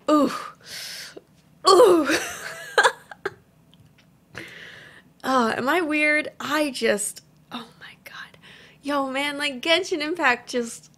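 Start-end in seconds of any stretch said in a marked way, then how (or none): 0.1-2.1 high, loud, tearful ooh; emotional squeal. Real, not acted. Overwhelmed fan reaction. 2.1-3.3 squealing high-pitched laugh; high giggle. Real, not acted. Overwhelmed fan reaction. 7.5-8.4 very soft, breathless whisper; awed whisper. Real, not acted. Awed at trailer.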